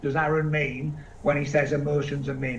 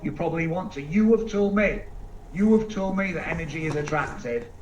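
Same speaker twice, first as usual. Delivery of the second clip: usual speaking voice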